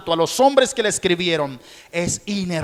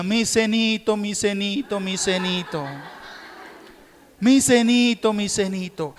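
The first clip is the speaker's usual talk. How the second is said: put-on whining child; 6 semitones higher than usual. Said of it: "lloraba y decía".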